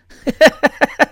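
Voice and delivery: high burst of laughter; loud laugh. Real, not acted. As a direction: crack up at your own line. Laughing at his own joke.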